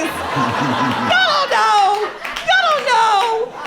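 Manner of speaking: high, loud, drawn-out holler; mock berating. Comic teasing audience about the accent, hollering. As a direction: stretch it out, hollering at the crowd.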